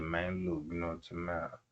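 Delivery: muttering to himself at the chat; muttered aside. Retold or imitated. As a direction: grumble it under your breath. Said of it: "I'm like".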